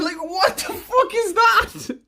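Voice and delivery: high, slow, cracking up; laughing disbelief. Real, not acted. Reacting to a TikTok video, laughing. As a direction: squeal it through real laughter.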